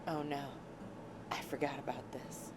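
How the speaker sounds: ordinary delivery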